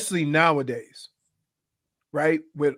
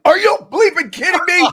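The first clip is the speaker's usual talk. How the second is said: much higher and louder, outraged; disbelieving outburst; 8 dB louder than usual. Retold or imitated. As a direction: yell it like you can't believe the touchdown went on without you. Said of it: "I'm like".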